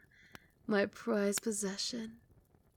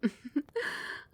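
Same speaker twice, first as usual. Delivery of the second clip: light knowing giggle; giggle, pleased they remember; 6 semitones higher than usual. Acted. Pet remembers first feeding.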